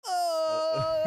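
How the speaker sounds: long, high, very loud "oh"; melon man's bellow. Retold or imitated. Costumed festival guy spotting the friends, huge shout. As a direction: holler it out in mock delighted recognition. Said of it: "and he's like".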